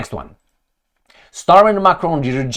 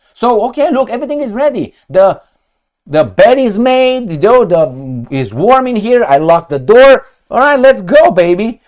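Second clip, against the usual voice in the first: a hammed-up lover, higher and louder, luring a partner in; mock seducer; 7 semitones higher, 5 dB louder than usual. Erdogan as a host welcoming his guest, hammed-up seduction. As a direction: coax them in like a smug host preparing the bedroom.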